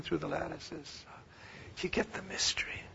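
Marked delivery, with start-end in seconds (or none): 1.8-2.8 hushed whisper to the congregation; a breathy hushed aside. Real, not acted. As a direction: whisper it like a secret. Hushed aside about mystery.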